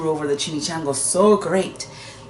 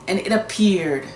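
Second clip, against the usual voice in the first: ordinary delivery, nothing marked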